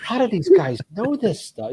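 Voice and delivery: high, amazed disbelief. Retold or imitated. Listener amazed by the car show brothers, high disbelief. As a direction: marvel at how much they know. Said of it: "And I'm like".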